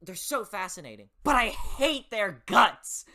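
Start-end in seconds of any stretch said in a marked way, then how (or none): 1.2-3.0 loud, high, slow angry outburst; fierce hatred. Real, not acted. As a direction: drag out every word with hatred. Ranting about hated villains.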